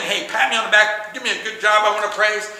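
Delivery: hammed-up show-off fishing for praise; mock bragging plea. Showing what bragging sounds like, a loud mock boast. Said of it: "You're not really saying".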